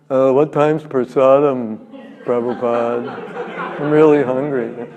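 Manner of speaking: slow, dopey, lazy question; dozy, distracted student; sleepy disciple. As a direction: drag the words out, half asleep. Lazy disciple asking for food.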